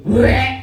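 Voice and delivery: a sharp, high gag that rises at the end; mock retching sound. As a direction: gag as if about to throw up. Mimicking nausea at the sight of vegetables. (suara pura-pura muntah yang naik)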